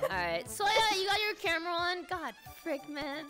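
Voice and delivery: ordinary talk, nothing marked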